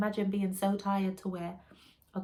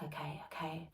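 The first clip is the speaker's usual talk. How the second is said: softer whisper, bracing herself; whispered okay; 3 semitones lower, 11 dB quieter than usual. Retold or imitated. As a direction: breathe it out to yourself as you gather courage. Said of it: "So I'm like".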